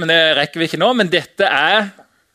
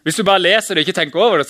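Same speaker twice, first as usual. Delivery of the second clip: ordinary delivery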